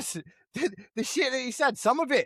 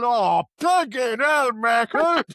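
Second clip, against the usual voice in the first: louder, slow hammed-up groan of disgust; mock outburst; 4 semitones higher, 6 dB louder, much slower than usual. Grandad cursing his grandson's fart, in a loud, slow mock groan. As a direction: drag out the curse like an old man hit by the smell. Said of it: "Pete's reaction retold".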